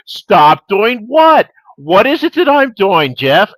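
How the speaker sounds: loud, high, drawn-out mock innocence. Uncle embarrassing his nephew before girls, loudly oblivious. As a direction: feign cluelessness at embarrassing volume. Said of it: "getting louder and, and totally acting oblivious".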